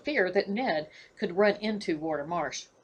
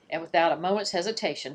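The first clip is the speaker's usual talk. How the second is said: plain reading voice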